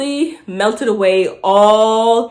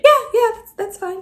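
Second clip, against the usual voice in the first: high, squeaky faked nonchalance; faking cool; 13 semitones higher than usual. Accepting the job offer, mock-casual high squeak. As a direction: try to sound cool but squeak. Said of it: "I'm trying to act cool".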